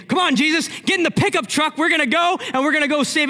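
high, loud rallying call; urgent urging. Acted. Jairus hurrying Jesus into the truck, urgent and high.

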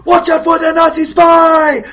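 very high, loud, stretched scream; screaming villagers. Acted. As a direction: scream a hammed-up warning. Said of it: "screaming other lines from".

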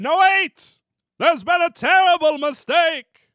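frantic producer correcting the Oscars mistake in a put-on accent. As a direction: blurt it out in a dramatic put-on accent. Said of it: "I don't know why I gave him that accent".

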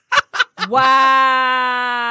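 shrieking burst of laughter; loud laughing outburst. Real, not acted. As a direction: crack up loudly.

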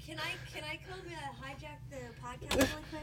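hushed, sheepish ask to cut in; soft, tentative request to hijack; quiet interruption. Real, not acted. Roommate [name] hushed asking to join the podcast, quiet.

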